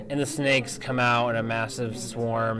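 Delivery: ordinary talk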